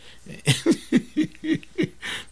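a high breathy burst of laughter; high airy laugh. Real, not acted. Preacher laughing at his own joke, airy and high.

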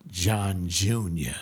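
low, slow, ominous mother's warning. Retold or imitated. Mother warning her son, low and slow. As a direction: drop it low like a mother about to punish. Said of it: "you know when she lowered her voice".

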